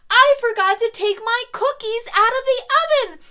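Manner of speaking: high, loud, fast fluster; hurried alarm. Acted. Mother Goose hurries to rescue her burning cookies. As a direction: rush off in a panic. Rushing to the kitchen.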